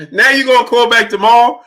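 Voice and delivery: high, loud laughing jeer; laugh, then fling it out high and incredulous; mocking laughter. Real, not acted. Host ridiculing caller's promise to return, mocking laughter.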